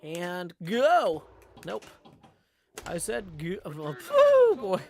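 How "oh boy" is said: high, loud squeaky groan; high exasperated. Real, not acted. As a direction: yelp at the flub. Reacting to missed shot.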